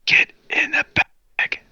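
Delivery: slow, drawn-out stage whisper; eerie, stretched-out whispering. Acted. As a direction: whisper it as a secret command. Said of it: "starts to whisper".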